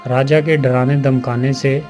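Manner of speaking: ordinary delivery, nothing marked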